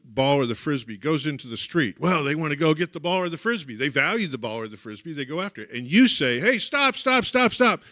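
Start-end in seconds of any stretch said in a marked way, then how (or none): 6.4-7.8 high urgent stop stop stop, loud; a parent shouting a sharp warning; parent's alarmed yell. Pretend. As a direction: yell at a child running toward the street. Parent yelling at child near street.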